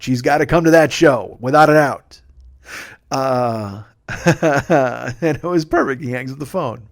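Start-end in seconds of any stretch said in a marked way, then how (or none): 4.1-5.2 real chuckling laugh. Real, not acted. Storyteller amused by the perfect call, real laugh. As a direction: laugh to yourself.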